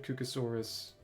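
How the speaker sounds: ordinary talk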